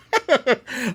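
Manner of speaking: loud high laugh; high burst of laughter. Real, not acted. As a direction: laugh out in surprise. Finding the cave item.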